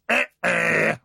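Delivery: buzzing game-show "wrong" sound, eh eh; wrong-answer buzzer imitation. Shown as a demonstration. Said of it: "same noise that a wrong answer on Family Fortunes gets".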